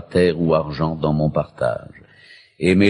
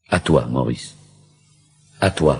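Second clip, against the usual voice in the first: ordinary talk, nothing marked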